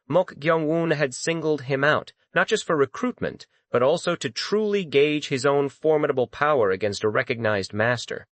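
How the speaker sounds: ordinary delivery, nothing marked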